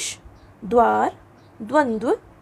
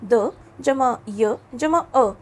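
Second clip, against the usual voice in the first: द जमा य जमा अ drawn out piece by piece; very slow spelling out; much slower than usual. Shown as a demonstration. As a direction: spell it out very slowly. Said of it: "इसके नीचे हलंत लगा दिया". (बहुत धीरे-धीरे अक्षर जोड़ते हुए)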